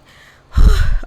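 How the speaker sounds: big audible sigh of resignation; resigned to skipping brunch, a loud sigh. Real, not acted. Sighing before the reply.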